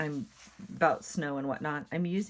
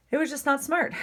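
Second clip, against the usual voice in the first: exasperated outburst; 12 semitones higher, 6 dB louder than usual. Real, not acted. Exasperated mom about son's plan.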